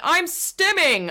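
high, slow, mocking sing-song take on a TikTok stimmer; mocking stimming claim. Mocking people who claim to stim, in a high slow mock voice. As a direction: drag it out high, making fun of the claim. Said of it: "this whole thing of, like".

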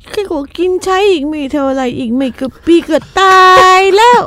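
high, whimpering old boomer voice with a mock sob; whiny boomer. Mocking the boomer generation in a high, teary whine. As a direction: play a self-pitying old boomer, near tears. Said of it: "ส่วนบูมเมอร์อย่างฉันก็ว่า".

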